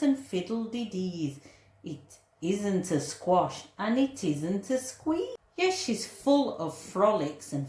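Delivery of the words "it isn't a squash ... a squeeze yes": plain reading voice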